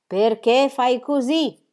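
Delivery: slow, unhurried question; phlegmatic. A narrator's reading. Mother at the table questioning her son, phlegmatic. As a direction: ask it slowly, unruffled. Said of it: "disse con la solita flemma".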